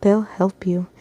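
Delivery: soft, weakened reassurance; choked out. A narrator's reading. Wounded mother reassuring daughter, choked. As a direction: push the words out faintly. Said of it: "she choked out".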